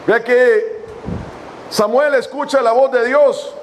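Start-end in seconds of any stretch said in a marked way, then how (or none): none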